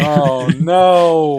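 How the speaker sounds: high, loud groan of despair; loud dismay. Real, not acted. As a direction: howl it in mock horror.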